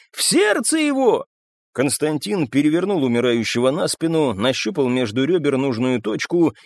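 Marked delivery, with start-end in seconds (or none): 0.1-1.2 high, urgent shouted instruction; crying out. A narrator's reading. Onlooker telling fighters where to stab, crying out. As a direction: urge them on from the crowd. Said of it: "крикнул кто-то из толпы".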